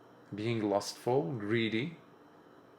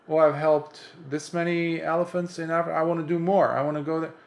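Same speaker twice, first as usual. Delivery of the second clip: higher, mock-eager boasting; eager do-gooder; 5 semitones higher, 4 dB louder, faster than usual. Do-gooder boasting of helping elephants, mock eager. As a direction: play someone hungry to do more.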